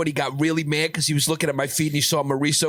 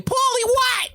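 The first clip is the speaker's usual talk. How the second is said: startled mother; much slower than usual. Retold or imitated. Voicing Paulie's mother at door.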